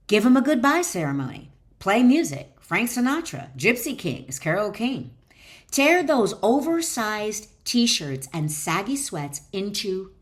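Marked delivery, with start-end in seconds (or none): none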